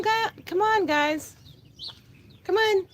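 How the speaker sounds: normal talking voice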